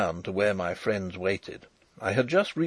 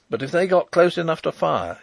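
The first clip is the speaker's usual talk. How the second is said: plain reading voice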